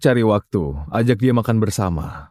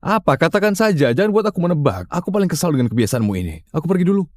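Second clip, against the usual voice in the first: impatient, raised-pitch annoyance; irritated; 8 semitones higher than usual. Acted. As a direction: snap at your partner for making you guess. Suami kesal didesak menebak. (kesal dan tidak sabar, nada meninggi)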